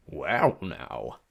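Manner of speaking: intrigued British drawl, dropping low; low, savouring British voice; British-like accent. A narrator's reading. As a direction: sink down greedily in a British accent. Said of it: "He had a strange accent. British maybe".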